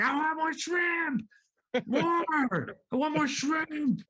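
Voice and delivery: high, slow, petulant demanding cry; whiny mock yell. Diner yelling at the restaurant over the missing shrimp, whiny mock yell. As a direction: wail it like a spoiled kid denied a treat. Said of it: "they're yelling at the restaurant".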